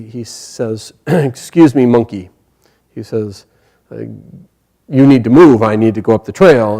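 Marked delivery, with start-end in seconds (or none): 1.1-2.3 louder, polite but firm address; firm request. Acted. Bhima to the monkey blocking the trail, firm request. 4.9-6.8 loud, insistent telling-off; firm demand. Acted. Bhima to the monkey blocking the trail, firm demand.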